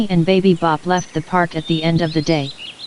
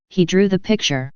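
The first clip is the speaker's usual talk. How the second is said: ordinary delivery, nothing marked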